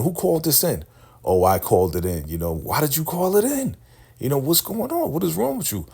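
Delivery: exasperated demand. Retold or imitated. Desiree confronts caller.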